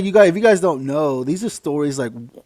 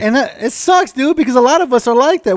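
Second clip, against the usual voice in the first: ordinary talk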